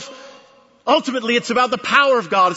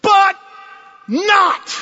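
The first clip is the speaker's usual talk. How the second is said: but not, shouted high and loud; triumphant shout; 11 semitones higher, 5 dB louder than usual. Real, not acted. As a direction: ring it out in triumph. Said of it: "That's the accent".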